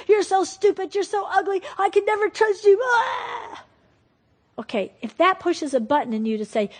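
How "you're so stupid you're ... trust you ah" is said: put-on high, shouted childish insults; a furious kid screaming at mom; child's tantrum. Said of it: "That kid goes off".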